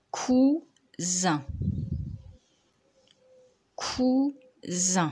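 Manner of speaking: « cousins » découpé en « cou… zins »; détache nettement chaque syllabe de « cousins »; dictée syllabe par syllabe. Shown as a demonstration. (dictée lente, syllabes séparées)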